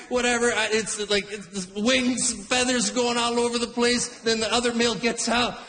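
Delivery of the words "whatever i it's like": ordinary talk, nothing marked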